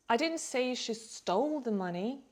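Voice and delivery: long, weighted "stole", implying another verb; stress on STOLE. Shown as a demonstration. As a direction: lean hard on "stole", ending on a rise. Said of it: "maybe she borrowed it".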